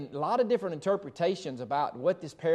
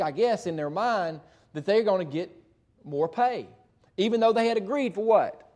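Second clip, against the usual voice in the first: usual speaking voice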